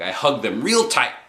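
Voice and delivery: ordinary delivery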